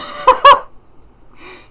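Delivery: loud, high cackling laugh; burst of laughter. Real, not acted. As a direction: crack up mid-story. Cracks up at own story.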